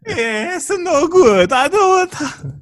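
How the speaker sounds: put-on Italian accent. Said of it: "I've done an Italian accent".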